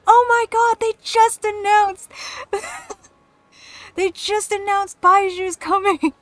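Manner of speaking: high, loud imitation of a thrilled friend; excited friend. Friend breaking game news to her, mock-excited. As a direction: squeal the big news, laughing.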